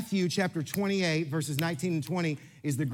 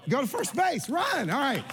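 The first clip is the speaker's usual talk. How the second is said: higher shout to the runner; calling out; 7 semitones higher than usual. Real, not acted. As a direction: call the kicker across the room to run. Calling runner during kickball demo.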